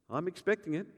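normal talking voice

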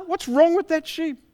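high, put-on puzzled voice; startled shepherd. Shepherd hearing an odd bleat, high and baffled.

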